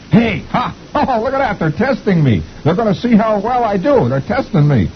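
high giggly cartoon private; delighted squeak with a laugh; gleeful Beetle. Beetle thinks officers are testing him, high and gleeful. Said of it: "Beetle Bailey says".

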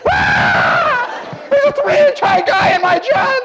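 high, hammed-up scream of alarm; panicked screaming. Someone fleeing into the street from a tiny man, screaming. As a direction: scream it out in mock terror. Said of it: "running into the street screaming".

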